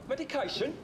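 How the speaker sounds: startled echo of the question; flustered surprise. Retold or imitated. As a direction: repeat it, pitch climbing in panic. Caught out by doctor's question.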